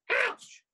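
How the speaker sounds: high loud ouch of sudden pain; pained yelp. Acted. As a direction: yelp as the prickles stab. Fox pricked by hedgehog.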